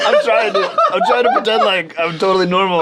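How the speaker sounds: laughing through the words; struggling to talk. Real, not acted. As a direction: try to sound normal while cracking up. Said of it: "Can you talk okay?".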